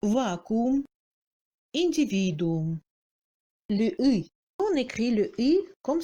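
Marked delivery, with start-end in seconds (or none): 0.0-2.8 Vacuum in Russian as "vakou-oum", both ou sounds; double OU. Shown as a demonstration. Teacher shows merged vowels, double OU. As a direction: individuum ending in a doubled ou. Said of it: "on prononce double ou".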